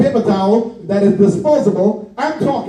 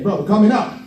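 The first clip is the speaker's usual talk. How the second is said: usual speaking voice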